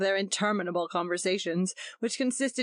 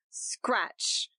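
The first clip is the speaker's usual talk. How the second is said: whispered scratch. A narrator's reading. Match striking sound.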